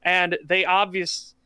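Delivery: usual speaking voice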